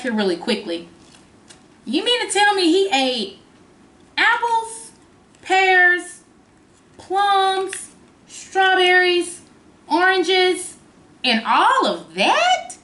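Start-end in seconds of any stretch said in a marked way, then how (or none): 1.8-12.8 high, playful disbelief; mock amazement. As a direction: act astonished for the kids. Mock disbelief at the food list.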